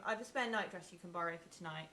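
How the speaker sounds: ordinary talk, nothing marked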